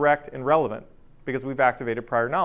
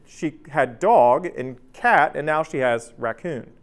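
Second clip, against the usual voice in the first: usual speaking voice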